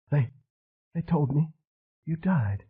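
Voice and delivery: a dazed woman near tears, voice up high; wounded madwoman. A narrator's reading. Madwoman with a gun telling Elvis she heard he died, high and tearful.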